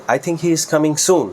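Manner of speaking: soon emphasised at the end of a slow sentence; stress on soon. Shown as a demonstration. Teacher shows he comes very quickly, stress on soon. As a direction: hit the final word soon. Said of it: "soon-এর উপরে".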